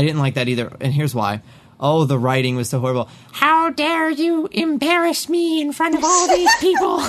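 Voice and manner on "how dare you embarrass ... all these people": high, slow, hammed-up villain shriek; mock the cartoon villain's outrage, badly; bad Skeletor impression. Recapping the cartoon, mocking Skeletor's bad line in a high shriek. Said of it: "that was a horrible impression of Skeletor".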